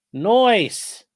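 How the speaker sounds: "Nice" as "noice", high, loud and falling; goofy voiced nice. Reading the weather to chat, a jokey high "noice". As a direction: toss off a cartoonish "noice". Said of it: "Seventy-two at the airport".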